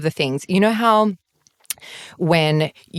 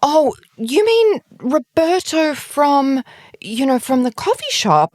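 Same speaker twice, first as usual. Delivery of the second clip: high, slow, hopeful guess; trying to help; 10 semitones higher than usual. Retold or imitated. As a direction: imitate someone desperately offering a possible match. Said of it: "She's trying. She's like".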